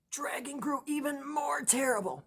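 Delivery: spooky whisper. A narrator's reading. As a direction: whisper it like a ghost story. Spooky climax of the story.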